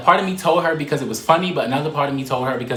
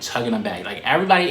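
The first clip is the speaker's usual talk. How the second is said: ordinary talk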